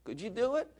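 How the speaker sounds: high-pitched imitation of a child; helpless child. Preacher playing child asking mom to tie shoe, high helpless voice. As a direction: plead like a little kid to Mom. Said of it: "when the child doesn't know what to do".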